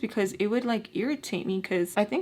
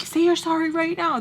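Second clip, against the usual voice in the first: high-pitched, urgent pretend order; mock movie heckling; 8 semitones higher, 4 dB louder than usual. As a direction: order a film character around, pitched up. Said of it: "through the screen".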